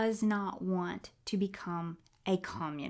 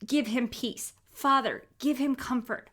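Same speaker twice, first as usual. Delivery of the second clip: prayer; 3 semitones higher than usual. Real, not acted. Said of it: "I'm gonna use words".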